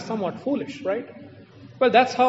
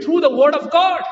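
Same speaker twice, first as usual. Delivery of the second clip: shouted God; 6 semitones higher, 7 dB louder than usual. Real, not acted. Preaching climax.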